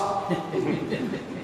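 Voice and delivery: soft breathy laugh; quiet chuckle. Real, not acted. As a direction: laugh at the joke. Laugh after punchline.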